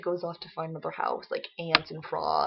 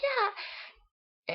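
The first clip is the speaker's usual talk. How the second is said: put-on high little-kid voice; imitating eager toddler. Child promising to go to bed, mock high eagerness. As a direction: chirp agreement like a small child.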